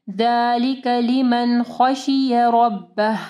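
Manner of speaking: slow chanted ذَلِكَ لِمَنْ خَشِيَ رَبَّهُ; thick r in رَبَّهُ, stopping on an audible h; heavy ra, ending h. Shown as a demonstration. Teacher models the verse ending for students, slow tajweed. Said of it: "रॉ को heavy पढ़ना है".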